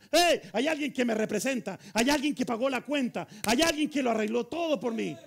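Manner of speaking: loud, bold proclamation; total confidence. Acted. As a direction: declare it like someone entitled to walk in. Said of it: "Totalmente confiada porque sabe".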